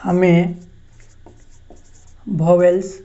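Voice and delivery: each word set apart by long pauses; slow dictation. Shown as a demonstration. Teacher dictating to students, long pauses between words. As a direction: dictate it slowly so students can write.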